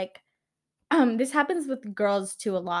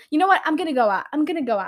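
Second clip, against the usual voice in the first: higher, louder mock resolve; worked-up defiance; 6 semitones higher, 6 dB louder, faster than usual. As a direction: imitate someone suddenly declaring a night out. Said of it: "they start getting mad".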